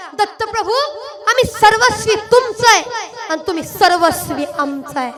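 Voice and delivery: devotee's prayer. Acted. Speaker voicing the crowd's prayer to Dattaprabhu, fervent. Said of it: "का माहिती का".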